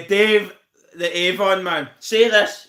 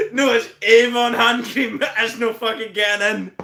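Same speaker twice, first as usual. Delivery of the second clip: ordinary talk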